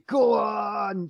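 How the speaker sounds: high, drawn-out mock heckle; jeering shout. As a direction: imitate a jeering crowd egging someone on. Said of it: "they start jeering you".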